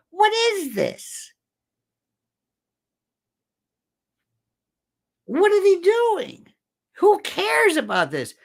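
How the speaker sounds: can't believe what he's seeing; incredulous. Real, not acted. Disbelief at the network's choices.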